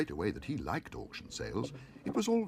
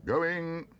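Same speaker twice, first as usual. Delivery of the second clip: high, loud auctioneer's call; 9 semitones higher, 5 dB louder than usual. A narrator's reading. Auctioneer calls the lot to the room. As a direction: ring it out over the hall. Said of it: "called the auctioneer".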